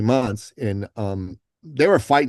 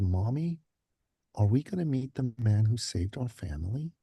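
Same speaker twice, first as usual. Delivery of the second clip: put-on softly, like a small vulnerable child; a hint of a soft little boy's voice; 8 dB quieter than usual. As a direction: say it gently, the way a small boy asks. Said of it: "his voice got really soft".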